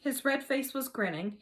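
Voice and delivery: ordinary delivery, nothing marked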